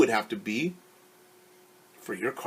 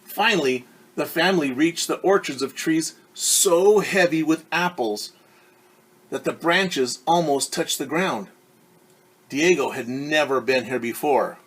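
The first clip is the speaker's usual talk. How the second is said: clear, lively read-aloud voice; storyteller reading a picture book; story narration; 4 semitones higher, 6 dB louder than usual. A narrator's reading. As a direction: read it to a class of kids.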